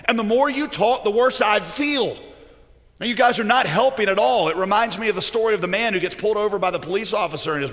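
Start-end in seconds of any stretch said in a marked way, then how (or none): 0.0-4.5 raised, pushed-out complaint to friends; acted exasperation, high and loud; exasperated. Job answering friends who blame him, exasperated.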